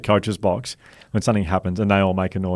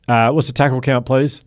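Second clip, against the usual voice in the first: calling out; 5 semitones higher, 5 dB louder than usual. Retold or imitated. Billy asking coaches during game.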